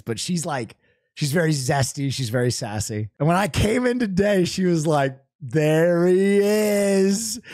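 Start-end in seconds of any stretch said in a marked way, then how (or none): 5.4-7.4 very slow, stretched welcome; drawn-out greeting. Retold or imitated. Front desk woman greeting the hero, slow stretched call.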